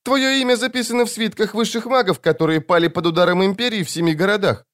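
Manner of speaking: put-on high, fast childlike recital; child-voiced demon. A narrator's reading. Demon recites what it knows of the wizard, quick child voice. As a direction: rattle it off in an eerie child's voice.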